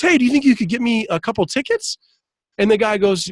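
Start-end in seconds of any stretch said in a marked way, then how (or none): 0.0-2.0 high, quick favor-asking; retold eager request. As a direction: imitate a driver angling for a favor. Retells cab driver asking a favor.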